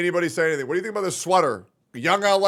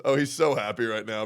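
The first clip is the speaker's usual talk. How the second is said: ordinary talk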